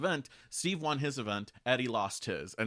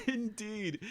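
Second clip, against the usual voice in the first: higher "Indeed" through a laugh; 12 semitones higher than usual. Real, not acted. Cohost agreeing with a laugh, higher. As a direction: laugh out the word.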